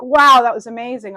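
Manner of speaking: loud, excited exclamation after the ride; thrilled rider. Retold or imitated. Reader imitating a thrilled roller coaster rider, loud and excited. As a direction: whoop it out like you just came off the loop. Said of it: "I'm thinking a roller coaster".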